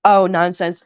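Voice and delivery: ordinary delivery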